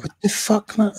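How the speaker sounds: retold disbelief, pitched far up; shocked outburst. Cyclist recalling being hit by a rucksack, shocked. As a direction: jolt the voice way up in outrage.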